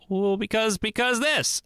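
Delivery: a partner getting defensive in mock; defensive reply. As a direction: snap back defensively, pitch jumping up. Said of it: "get defensive and go".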